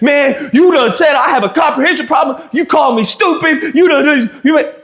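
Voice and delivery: a friend mocked for getting upset; mock upset Keith. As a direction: imitate a man taking offence. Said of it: "So Keith starts getting upset".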